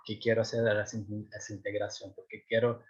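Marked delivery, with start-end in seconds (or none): none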